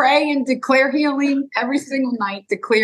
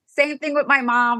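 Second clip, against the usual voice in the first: ordinary talk, nothing marked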